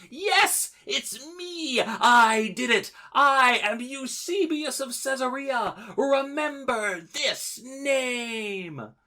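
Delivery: high, drawn-out gloating yell; villain's triumphant shout. Acted. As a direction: shout it like an escaping cartoon villain. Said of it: "as he shouts".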